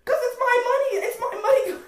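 high, loud laughing protest. Real, not acted. Arguing over boxes with a friend, laughing loudly. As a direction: squeal it through laughter.

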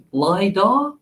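attempted Aussie "lidar?" with rising tune; Australian accent. Shown as a demonstration. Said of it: "How do I do this in Australian accent?".

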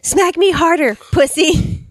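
loud, high barked yell; barking gym coach. Retold or imitated. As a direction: bark it like a furious coach. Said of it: "the coach yelled".

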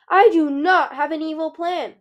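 high, loud, flustered denial; rising alarm in an emphatic denial; starting to panic. A narrator's reading. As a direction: protest loudly as panic starts. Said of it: "Pearl said, starting to panic".

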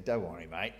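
broad Aussie reassurance. Pretend. Preacher giving God an Aussie voice, relaxed Australian. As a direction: say it like a laid-back Australian mate. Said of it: "That's the, the Aussie version".

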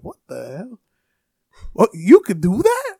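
mock southern astonishment; southern accent. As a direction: imitate a baffled southern girl, building in pitch. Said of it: "putting on this southern accent".

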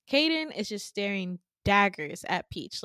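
plain reading voice

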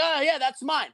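high mock innocent voice; claiming it. Claiming the order.

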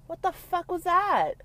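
high, loud, incredulous; what was THAT, shrieked in disbelief; outraged disbelief. Real, not acted. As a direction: blurt it out in shock. Outraged at the rose coffin.

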